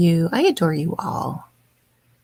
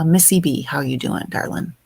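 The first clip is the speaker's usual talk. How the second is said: normal talking voice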